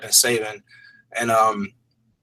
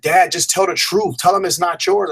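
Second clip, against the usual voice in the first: put-on higher, urgent plea from a teen; pleading daughter; 6 semitones higher than usual. Daughter begging her dad to confess, higher and urgent. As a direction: beg him to come clean, voice raised high.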